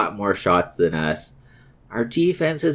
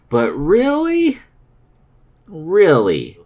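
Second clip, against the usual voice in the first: disbelief; 7 semitones higher, much slower than usual. Real, not acted. Disbelief at late goal.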